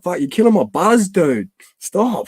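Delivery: high-pitched retold complaint; mock protest. Partygoer telling a mate he's killing the buzz, mock protest. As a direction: plead in mock exasperation, jumping up in pitch.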